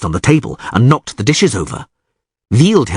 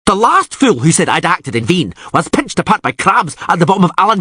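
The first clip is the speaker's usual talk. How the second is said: higher, blustering rant of a furious islander; a hot-tempered warrior's raised tirade; angry ranting; 9 semitones higher than usual. Acted. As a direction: rage at the rival suitor. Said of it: "Krach an Kreit yelled".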